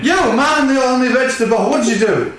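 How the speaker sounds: loud, high berating yell; angry shout. Acted. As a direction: tear into them loudly. Character berates the fighters.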